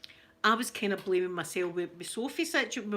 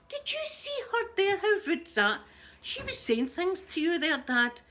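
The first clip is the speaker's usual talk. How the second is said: high, indignant retold outrage; indignant retelling; 10 semitones higher than usual. Telling dad about the rude lady, high indignant outrage. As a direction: replay your shocked outrage in a much higher voice. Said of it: "And I was saying to my dad".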